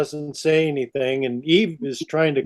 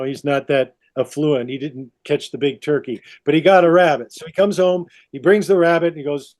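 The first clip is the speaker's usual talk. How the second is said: normal talking voice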